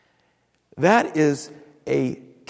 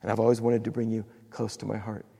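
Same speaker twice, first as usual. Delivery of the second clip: softer, lower loving voice of God; gentle, hushed reassurance from God; tender God; 6 semitones lower, 7 dB quieter than usual. Pretend. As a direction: speak like a father holding a child. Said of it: "Then God takes us up in His arms and He says".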